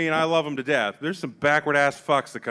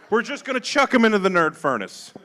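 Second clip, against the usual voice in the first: ordinary delivery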